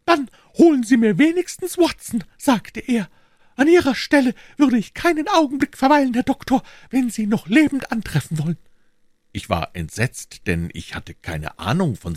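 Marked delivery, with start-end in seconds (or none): 0.1-8.6 put-on high, worried old housekeeper voice; fretting landlady pleading urgently; anxious housekeeper. Housekeeper relays urgent message.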